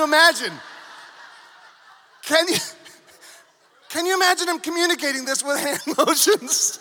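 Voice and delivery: high, loud, cracking up mid-sentence; laughing. Real, not acted. Preacher giggling over mimed instructions to a wife, laughing. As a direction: push the words out through real laughter.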